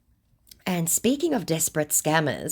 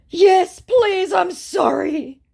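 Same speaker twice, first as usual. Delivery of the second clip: very high, loud fake whimper; hammed-up crying apology; mock tearful pleading; 7 dB louder, much slower than usual. Scammer begs to follow her again, mock crying. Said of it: "Crying emoji, sad face".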